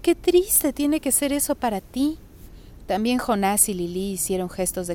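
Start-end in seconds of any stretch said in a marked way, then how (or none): 0.0-2.2 slow, higher-pitched sympathy; tender commiseration, drawn out; compassionate. A narrator's reading. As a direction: lift the voice and linger, feeling for them. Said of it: "murmuró mamá compasiva".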